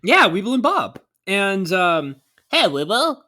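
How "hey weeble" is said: high silly cartoon voice; squeaky egg character. Quoting cartoon characters' dialogue.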